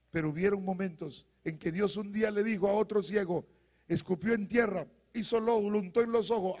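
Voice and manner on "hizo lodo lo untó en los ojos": usual speaking voice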